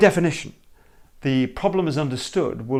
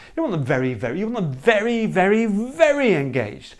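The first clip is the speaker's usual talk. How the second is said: each "very" rising higher and louder, slowing on "very, very engaged"; piling up very; 8 semitones higher, 4 dB louder than usual. Shown as a demonstration. Coach showing the team how repetition builds intensity. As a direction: build the repeated "very" into a crescendo. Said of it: "Repetition of words".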